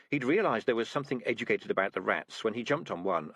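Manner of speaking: ordinary delivery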